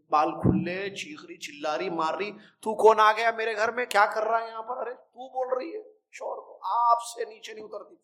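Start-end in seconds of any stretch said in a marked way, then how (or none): 2.3-8.0 higher-pitched imitation of a wife yelling at her husband, as if possessed; scolding tirade in a raised pitch; imitating a shrieking, furious wife; mock angry wife. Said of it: "چیخ رہی ہے".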